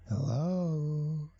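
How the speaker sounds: high, long stretched greeting; affected high character voice, lingering on the vowel; drawn-out, whiny Jack. A narrator's reading. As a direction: sing out a slow, creepy hello. Jack's voice again at the door.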